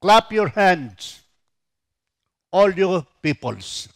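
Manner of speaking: loud, high, slow call to praise; proclaiming loudly. Acted. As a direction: ring out each phrase like a summons to worship. Preacher proclaims psalm to congregation.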